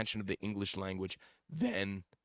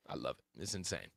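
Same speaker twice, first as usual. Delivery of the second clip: usual speaking voice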